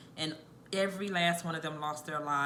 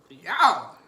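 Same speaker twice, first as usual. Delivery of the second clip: loud call out; 12 dB louder than usual. Real, not acted. Calling out during the burn.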